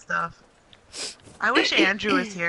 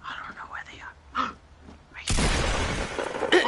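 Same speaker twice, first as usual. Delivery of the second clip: urgent hushed whisper; whispering while hiding; 6 semitones higher, 4 dB quieter, slower than usual. Real, not acted. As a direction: breathe it so nobody hears. Hiding, enemies right there.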